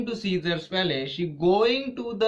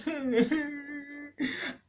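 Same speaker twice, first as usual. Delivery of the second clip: mock sob; 11 semitones higher, 4 dB quieter than usual. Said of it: "she crying".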